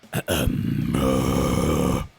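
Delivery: low false chord rasp; deep rasping growl. Shown as a demonstration. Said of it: "it helped me to go lower".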